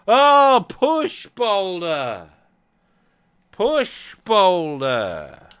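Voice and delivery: high, loud, dragged-out push boulder; exasperated slow shout. Real, not acted. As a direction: shout it slowly in frustration. Game refuses command, frustrated.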